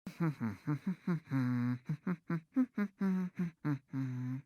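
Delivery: a short, soft self-satisfied laugh; amused laugh. Acted. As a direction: laugh at your own cleverness. Amused by her own remark.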